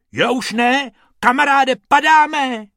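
high, loud alarmed call; alarmed cry. Acted. Rabbit warning his friend they are falling, alarmed. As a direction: cry out as the fall begins.

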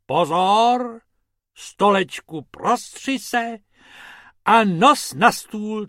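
loud, high incantation; magic command. Acted. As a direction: call out the spell with a flourish.

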